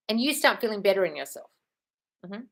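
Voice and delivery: ordinary talk, nothing marked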